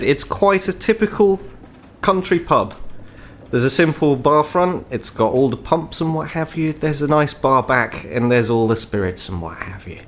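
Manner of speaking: ordinary delivery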